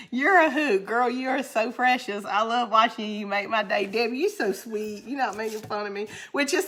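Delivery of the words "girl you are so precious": usual speaking voice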